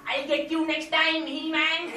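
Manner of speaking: high, loud, slow cartoon villain whine; Skeletor voice. Comic mimics cartoon villain yelling at his rival. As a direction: sneer it like a thwarted cartoon villain.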